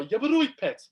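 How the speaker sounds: hammed-up Wolverhampton chat-up line; mock Wolverhampton accent. Mocking his own accent to his co-hosts, exaggerated Wolverhampton accent. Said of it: "wooed by a Wolverhampton accent".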